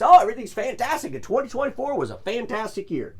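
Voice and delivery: high-pitched, hammed-up corporate cheer; sarcastically upbeat company voice; mock enthusiasm. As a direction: mimic a company glossing over bad news. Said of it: "It seems like Canon just".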